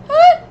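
very high, loud squeal; high scream. Real, not acted. Gamer dreading the screaming enemy, sudden high scream. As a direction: let out a sudden piercing scream.

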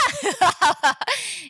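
long bursting belly laugh. Real, not acted. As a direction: crack up in surprise. Laughs at İskender seller appearing. (kahkahayı basma)

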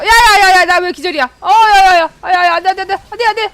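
high, loud repeated pleas; desperate shouting. Real, not acted. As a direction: cry out no over and over. Desperate shouting as character dies. (다급한 절규)

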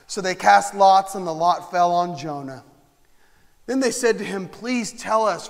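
loud, driving preaching of the text; fervent scripture reading. Acted. Preacher reading Jonah aloud to the congregation, loud and fervent. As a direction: thunder out the sailors' questions.

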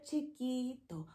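soft little voice; small voice. Shown as a demonstration. Teacher showing chiquito in a soft, small voice. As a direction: drop to a tiny volume. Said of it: "big and small".

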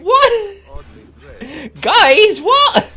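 very high, loud disbelief; shrill, loud protest; incredulous yelp. Real, not acted. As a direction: yelp in bewilderment at your teammates. Baffled as his guards desert him.

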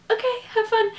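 awkward sign-off. Real, not acted. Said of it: "I told an awkward story".

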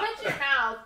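put-on loud, high Southern accent. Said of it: "always comes out with their accent".

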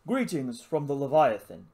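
crisp, plummy English officer's greeting; posh accent. Acted. Lizard relays the officer's greeting, posh English accent. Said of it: "It spoke with a posh accent".

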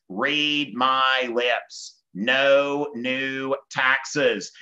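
very slow, drawn-out politician's pledge; Bush convention pledge. Acted. Bush to convention to excite conservatives, very slow emphatic pledge. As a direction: spell it out word by word for a crowd. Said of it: "he says".

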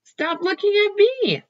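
high, loud put-on elephant voice. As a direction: snap at the staring turtle.

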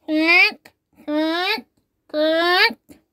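high clicking robot noises; robot clicks. Acted. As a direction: click like a clockwork toy. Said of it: "do a very funny toy robot walk".

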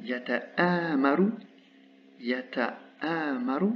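yata'amaru spelled out very slowly, every syllable apart; slow demo. Shown as a demonstration. Teacher repeating the word slowly for students. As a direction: drag out yata'amaru so the long aa is heard. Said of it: "la madda en position médiane".

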